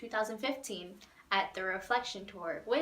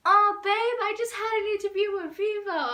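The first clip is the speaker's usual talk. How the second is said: much higher, louder squealing reply; excited Jacob; 11 semitones higher, 9 dB louder than usual. Retold or imitated. Imitating Jacob thrilled she runs a fan page, much higher and louder. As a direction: squeal it out with delight.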